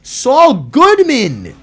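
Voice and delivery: "Saul Goodman" said slow, high and loud, each word drawn out; correct name. Shown as a demonstration. Gamer correcting his slip to viewers, slow and loud. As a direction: spell out the right name slowly. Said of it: "Who the hell is Saul Goodwin?".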